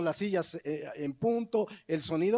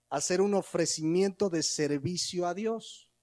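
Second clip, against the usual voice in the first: ordinary talk, nothing marked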